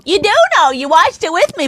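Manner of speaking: hammed-up insistent husband; mock insistent husband. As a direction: protest that she saw it too. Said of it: "And then I go".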